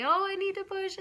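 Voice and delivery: high, thin little plea between the fighting moms; tiny high voice, asking meekly; small pleading voice. Retold or imitated. To the arguing moms, high small plea. Labouring mum cuts into fight.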